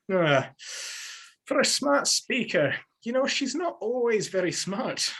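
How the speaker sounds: put-on granddad's thick Scottish accent; imitating a Scottish granddad; broad scottish accent. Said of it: "his thick Scottish accent".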